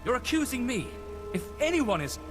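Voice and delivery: high-pitched, affronted protest; indignant retort, pitched high. Acted. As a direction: throw the accusation back, offended. Quarrel over accusation.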